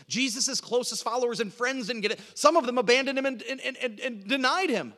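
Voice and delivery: high, forceful, incredulous preacher; urgent pulpit crescendo; impassioned preaching. Acted. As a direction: preach it hard, pitched well up. Preacher builds to full force.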